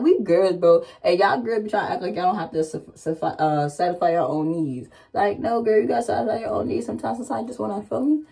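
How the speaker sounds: ordinary talk, nothing marked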